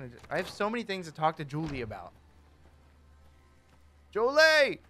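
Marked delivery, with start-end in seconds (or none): 4.1-4.8 loud high call across the room. Real, not acted. Player calling out for Julie, loud. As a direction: shout her name to find her.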